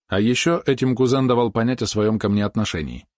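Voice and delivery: ordinary delivery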